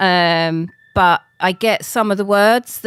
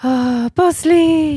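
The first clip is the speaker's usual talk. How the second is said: high, loud, drawn-out coo; adoring coo; 8 semitones higher, 6 dB louder, slower than usual. Real, not acted. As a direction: gush over your adorable dog. Cooing over her cute dog.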